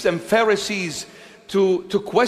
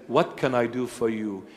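gentle Jesus; 8 semitones lower, 6 dB quieter than usual. Acted. Jesus voiced gently to believer.

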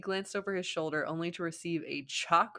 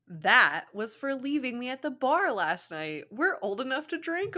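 put-on high, louder girly voice for Ino; voicing Ino; 7 semitones higher, 4 dB louder than usual. Reader voices Ino scolding Shikamaru, high girly voice. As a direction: play the annoyed blonde girl.

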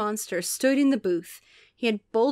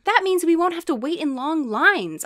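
put-on eager kid's exclamation, higher; excited exclaiming; 7 semitones higher, 4 dB louder than usual. A narrator's reading. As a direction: exclaim it with a lift in pitch. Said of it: "I quickly exclaimed".

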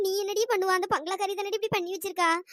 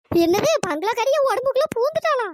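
higher-pitched, startled question; shocked disbelief; 5 semitones higher, 4 dB louder than usual. Acted. Woman told a spirit entered her body, shocked. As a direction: blurt it out in alarmed disbelief. Told she has been possessed.

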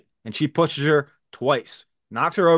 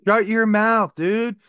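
mock yell; 8 semitones higher, 3 dB louder, much slower than usual. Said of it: "yelling at the screen".

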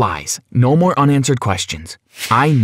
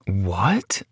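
soft, low mumble; mumbled; 4 semitones lower, 6 dB quieter than usual. Acted. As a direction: barely get the word out, stunned. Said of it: "I mumbled".

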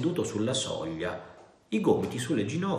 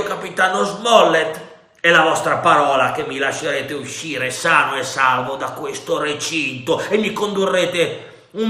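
loud, high pirate haggling; smooth-talk the captain; Silver bargaining; 7 semitones higher, 10 dB louder than usual. A narrator's reading.

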